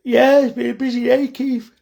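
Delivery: high and loud after a sigh; sighing high reply. Real, not acted. Butcher answering viewer Keith after a busy day, high sighing voice. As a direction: let it out on a tired exhale.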